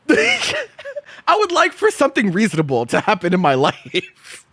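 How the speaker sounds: plaintive plea breaking into laughter; laughing despair. Real, not acted. Streamer wishing for something reasonable, laughing in despair.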